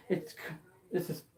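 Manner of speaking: plain reading voice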